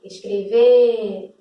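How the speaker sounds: "escrever" with a long, loud final VER; stress on final syllable. Shown as a demonstration. Teacher showing which syllable is tonic, final syllable held long. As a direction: stretch and punch the last syllable of "escrever". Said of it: "Sílaba tônica é o V".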